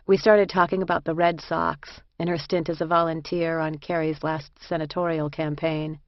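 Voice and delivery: ordinary delivery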